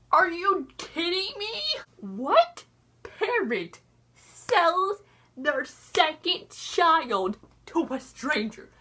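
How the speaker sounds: slow, emphatic disbelief, each word drawn out; incredulous outrage. Retold or imitated. Viewer appalled that Rumple sold his baby, incredulous. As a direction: spell out the outrage slowly.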